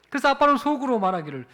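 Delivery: ordinary talk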